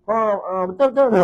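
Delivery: high, loud gushing yes-man; mock eager agreement with everything. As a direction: play a listener who nods along without thinking. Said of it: "hangguk-hangguk".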